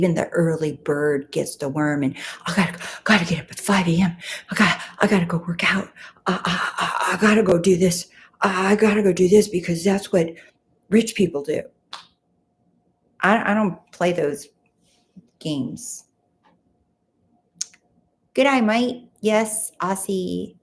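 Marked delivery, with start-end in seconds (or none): 2.5-11.6 fast, frantic mock early-riser voice; mocking hustler. Streamer imitating driven early risers to viewers, mocking and rushed. 18.3-19.1 playful Aussie greeting, pitched up; broad Australian vowels on "mate"; Australian accent. Acted. Streamer greeting an Aussie viewer, playful Australian accent. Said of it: "You're a natural Aussie".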